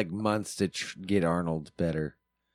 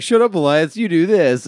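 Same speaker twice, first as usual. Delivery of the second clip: louder mock scolding; a raised-voice jab at Elias; imitating Nathan; 9 semitones higher, 8 dB louder than usual. Co-host mimicking Nathan snapping at Elias. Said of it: "other ways he's just like".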